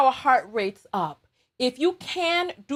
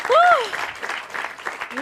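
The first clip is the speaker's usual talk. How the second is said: breathless whoop; 10 semitones higher than usual. Real, not acted. After exercise.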